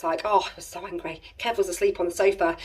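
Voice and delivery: ordinary talk, nothing marked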